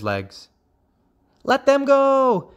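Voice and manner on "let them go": high, loud, drawn-out frog cry. A narrator's reading. Young frogs to the hawk, high loud cry. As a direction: shout high like a crowd of little frogs. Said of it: "cried nine hundred and ninety-nine young frogs".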